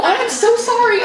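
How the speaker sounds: frantic apology. Retold or imitated. Author apologizing to the Zoom call, frantic. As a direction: gush it out as if you joined the wrong call. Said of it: "so I'm frantic".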